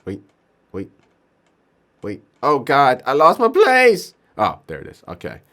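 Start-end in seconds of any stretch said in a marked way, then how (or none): 2.4-4.1 loud, climbing, drawn-out lament; exasperated wail. Real, not acted. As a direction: wail that it's all lost. Loses place on screen.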